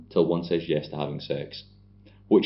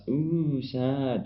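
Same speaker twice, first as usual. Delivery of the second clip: high, soft attempt at an Australian accent; badly faked Australian drawl, pitched high; bad Aussie accent; 6 semitones higher, 5 dB quieter than usual. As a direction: have a poor go at sounding Aussie. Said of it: "Can't do Australian accents".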